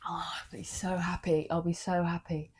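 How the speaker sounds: a hushed, moved whisper; emotional whisper. Real, not acted. Hopeful actor picturing an Oxford offer, whispering emotionally. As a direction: barely voice it, full of longing. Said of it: "I'm getting emotional thinking about it".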